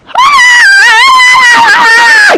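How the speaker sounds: very high, loud mock wail; hammed-up scream. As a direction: let out an overjoyed theatrical shriek. Acting out thrilled reaction to praise.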